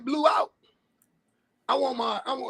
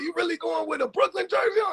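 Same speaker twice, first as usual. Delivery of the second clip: higher-pitched incredulous question; retold disbelief; 6 semitones higher than usual. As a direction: imitate someone who can't believe it. Retelling an uncle's incredulous question.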